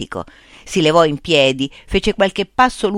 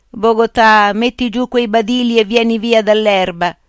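villager calling; 5 semitones higher, 5 dB louder than usual. A narrator's reading. Villager orders him to come away.

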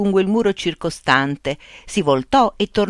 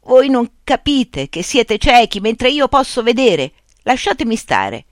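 pleading shout; 7 semitones higher, 4 dB louder than usual. A narrator's reading. Nunez pleads with the blind crowd.

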